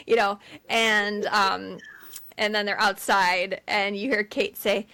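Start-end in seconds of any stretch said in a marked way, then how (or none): none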